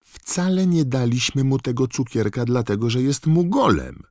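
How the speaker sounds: loud, high-pitched indignation; wronged son crying out in protest; indignant protest. A narrator's reading. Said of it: "oburzył się Fred".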